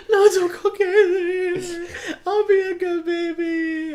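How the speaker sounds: put-on high, slow wailing plea of a scared little kid; crying child. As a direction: beg through sobs like a four-year-old. Child begging mom, crying.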